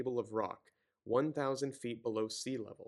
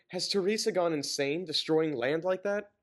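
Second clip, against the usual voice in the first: raised, higher voice of a distraught mother; crying complaint; 6 semitones higher, 5 dB louder than usual. Acted. Mothers on television crying out, loud and upset. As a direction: sob it out in fear and outrage. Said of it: "mothers holding their kids and crying".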